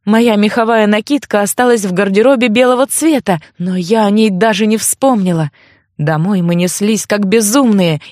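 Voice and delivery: ordinary delivery, nothing marked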